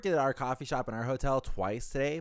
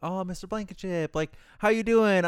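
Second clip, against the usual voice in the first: higher, bright eager greeting from the bellman; chirpy bellman; 8 semitones higher, 4 dB louder than usual. Retold or imitated. The bellman greeting his coworker, higher and eager. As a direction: play the overly friendly coworker. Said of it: "he was just like".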